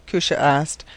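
usual speaking voice

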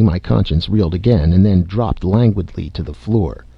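plain reading voice

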